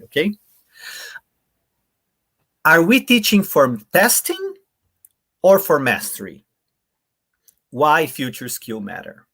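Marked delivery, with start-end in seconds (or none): 2.6-6.4 "we" लंबा और ऊँचा उठता है; stress on WE. Shown as a demonstration. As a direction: lean on the word we, rising. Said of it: "if I read it this way".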